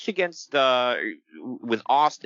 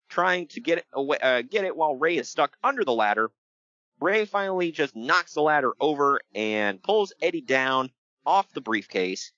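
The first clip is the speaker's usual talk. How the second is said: normal talking voice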